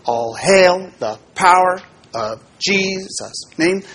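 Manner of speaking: "All hail the power of Jesus' name" slow, with "hail" stressed and rising; iambic stress demo. Shown as a demonstration. Teacher showing the unstressed-stressed pattern slowly. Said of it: "first syllable is unstressed and the second one is stressed".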